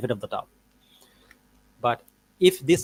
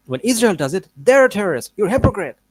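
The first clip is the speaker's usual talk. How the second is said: higher, louder mock accusing quote; mock accusation; 8 semitones higher, 7 dB louder than usual. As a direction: throw out an exaggerated accusation, imitating an opponent. Mocks opponent's accusation in acted quote.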